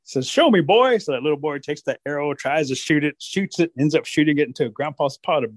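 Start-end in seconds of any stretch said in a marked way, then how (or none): 0.3-1.0 high, loud challenge; challenging grandpa. Acted. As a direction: call the boy out to prove it.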